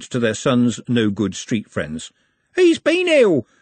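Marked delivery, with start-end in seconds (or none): 2.6-3.5 high, loud, shrill-free announcement from a grudging mum; a mother's loud high pronouncement at the door; announcing mother. Acted. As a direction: announce it like a put-upon mother, much higher and louder. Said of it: "she announced".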